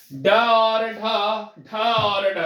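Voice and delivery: ड और ढ said loud and punched, each letter hit; emphatic announcement. Shown as a demonstration. Teacher announcing next rule to students, loud emphasis. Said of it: "ध्यान दीजिएगा".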